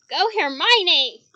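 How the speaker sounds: loud, slow cheer; loud slow rallying cry. Acted. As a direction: shout her on, drawn out. Cheering Hermione on.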